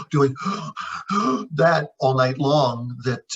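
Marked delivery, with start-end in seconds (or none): 0.4-1.5 sharp rising gasp for breath; imitated covid gasp; gasping for air. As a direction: gulp in air as if unable to breathe.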